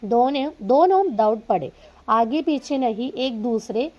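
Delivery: slow, deliberate read-aloud; teacher reading a story to students. Acted. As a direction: read each word out carefully for the class. Reads Hindi story aloud.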